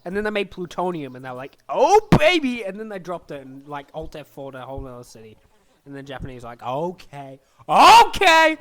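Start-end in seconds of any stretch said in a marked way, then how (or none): 1.7-2.6 high, loud whoop of delight; over-the-top thrilled; mock gleeful. Acted glee at new bomb. 7.7-8.6 high, loud cry of giving up; mock panicked yell; exasperated surrender. Second, escalated surrender cry.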